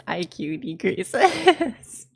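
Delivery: drawn-out words breaking into laughter; laughing. Real, not acted. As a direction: start cracking up as you finish. Cracking up mid-sentence.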